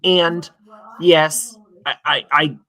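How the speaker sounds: usual speaking voice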